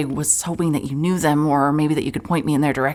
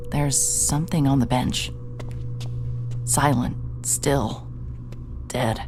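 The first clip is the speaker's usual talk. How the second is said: story narration; 4 semitones lower than usual. A narrator's reading. Detective narrating a creepy lab to listeners, hushed narration. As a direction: set an eerie scene, unhurried. Narrator describes dark laboratory.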